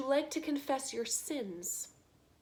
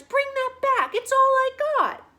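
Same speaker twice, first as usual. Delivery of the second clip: much higher, much louder desperate yell; desperate shout; 14 dB louder than usual. Acted. Prisoner screaming after the rat for his only possession. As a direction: scream it like it's all you own. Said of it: "shouted the prisoners".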